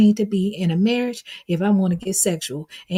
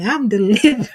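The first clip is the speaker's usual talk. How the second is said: laughing through the words; giggling while saying it; 4 dB louder than usual. Real, not acted. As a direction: break into a laugh mid-line.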